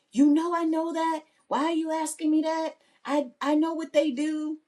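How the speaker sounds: high-pitched mock husband retort; high, insistent mimicked protest; put-upon husband. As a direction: imitate an exasperated spouse, voice pushed up. Husband's stock retort, retold.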